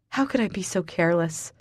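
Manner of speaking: usual speaking voice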